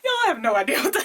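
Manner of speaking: loud, high, breaking off into laughter; flabbergasted. Real, not acted. Unboxer baffled by protein supplement, loud disbelief. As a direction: blurt it out, losing the words. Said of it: "I'm so speechless".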